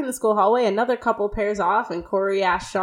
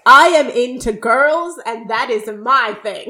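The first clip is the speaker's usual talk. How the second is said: mock bold boast; 3 semitones higher, 7 dB louder, slower than usual. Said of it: "he's immediately like".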